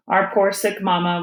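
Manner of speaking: ordinary delivery, nothing marked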